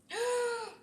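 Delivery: long, high-pitched gasp; amazed gasp. Real, not acted. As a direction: draw in a high gasp of awe. Amazed at her own dish.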